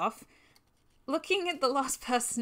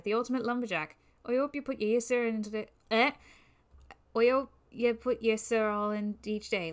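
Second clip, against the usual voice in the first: bad Irish accent. A big lumberjack greets the biker in a bad Irish accent. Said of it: "I'm gonna screw up this Irish accent".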